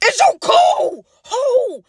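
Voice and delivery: high, slow, stunned disbelief; how could you, dragged out high; shocked disbelief. Acted. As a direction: stretch it out in horror at her friend. Shocked reaction to Peyton's hint.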